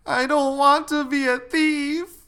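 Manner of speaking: put-on loud, very high toddler wail; crying little girl. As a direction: bawl it out like a distressed small child. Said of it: "she started crying and said".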